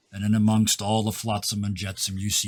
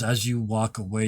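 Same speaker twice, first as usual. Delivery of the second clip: usual speaking voice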